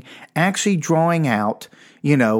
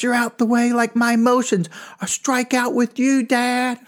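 put-on high, sing-song kid's field chatter; little-league chatter; 11 semitones higher than usual. Boy pleading to his dad from the field, high sing-song chant. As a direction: chant it like a needy kid in the outfield.

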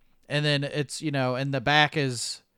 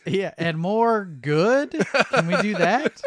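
slow, louder, higher pretend note; mock acting coach; 6 semitones higher, 6 dB louder than usual. Co-host playing teacher giving Brent notes, slow mock coaching. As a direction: coax a bad actor slowly, hammed-up.

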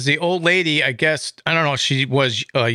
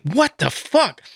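stunned; 8 semitones higher, slower than usual. Retold or imitated. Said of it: "I was like".

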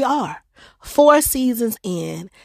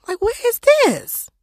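puzzled; 3 dB louder than usual. Real, not acted. Puzzled at unknown countries.

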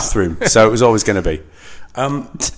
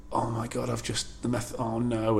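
softer, slightly higher acted panic; hushed mock dismay; 4 semitones higher, 8 dB quieter than usual. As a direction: play a quiet dawning realisation. Acts out character realising the loss.

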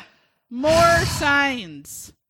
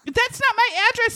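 mock indignant; 12 semitones higher than usual. Continued retold telling off.

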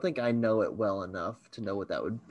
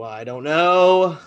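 mock exasperation; 4 semitones higher, 7 dB louder, slower than usual. Repeats it louder, higher.

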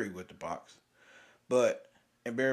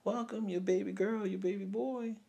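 higher, sunny hospital-nurse congratulations; cheerful nurse greeting; 8 semitones higher, 6 dB quieter than usual. Retold or imitated. Nurse congratulating new parents, cheerful and higher. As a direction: chirp it like a happy nurse. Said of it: "You hear nurses coming in and say".

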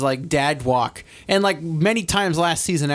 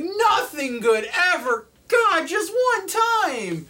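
very high, drawn-out mock wail; hammed-up slow, pleading howl; imitating despairing dad; much slower than usual. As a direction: whine it slowly to the heavens, near-crying. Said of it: "He's like".

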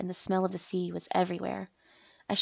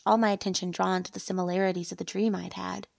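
ordinary delivery, nothing marked

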